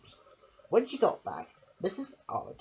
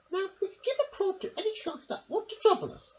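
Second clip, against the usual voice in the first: much higher, squeaky little lady voice; Missus Armitage voice; faster than usual. Acted. Missus Armitage asks her dog a question, high and squeaky. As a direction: pipe it up like a fussy little lady. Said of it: "said Missus Armitage".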